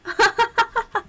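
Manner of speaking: squealing high laughter; high helpless laugh. Real, not acted. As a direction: laugh hard, pitch way up. Laughing at chat.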